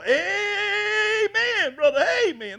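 high, loud, long-drawn amen shout; hollered amen. Retold or imitated. Churchgoer cheering on the preacher, imitated loud hollering. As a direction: imitate a rowdy worshipper, stretching the amen. Acting out rowdy church amens.

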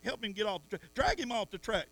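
ordinary talk, nothing marked

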